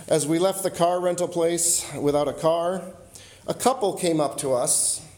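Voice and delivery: normal talking voice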